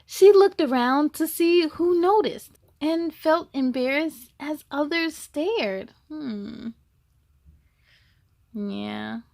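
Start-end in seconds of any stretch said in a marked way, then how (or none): 0.0-5.9 louder, lively storybook narration; reading to children. A narrator's reading. Reading the zebra story to kids, lively and louder. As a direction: tell it brightly to the little ones. Reads the picture book aloud.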